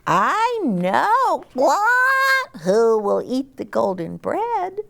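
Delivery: very high, clucky hen voice. Acted. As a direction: squeak it out like a busy little hen.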